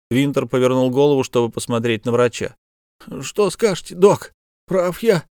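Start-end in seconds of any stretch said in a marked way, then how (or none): 3.2-5.3 pained, strained bandit voice; wounded bandit. Acted. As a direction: speak through fresh wounds. Dying bandit asks doctor.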